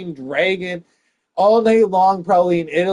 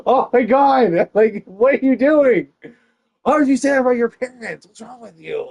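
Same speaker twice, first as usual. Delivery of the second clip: high, loud mock shock; mock outrage; 8 semitones higher, 6 dB louder than usual. Comic acting appalled at herself, high and loud. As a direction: play appalled at your own words.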